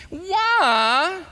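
high, drawn-out mock-indignant why; hammed-up sarcastic challenge. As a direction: stretch it out with exaggerated sarcasm. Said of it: "hear the sarcasm".

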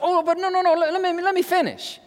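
high-pitched, stammered insistence; acted plea to keep talking; insistent interruption. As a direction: cut in high, holding off an objection. Acted storyteller brushing off interruption.

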